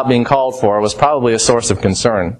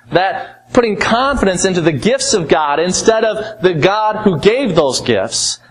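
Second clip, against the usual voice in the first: ordinary talk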